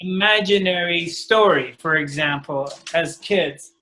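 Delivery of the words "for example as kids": ordinary talk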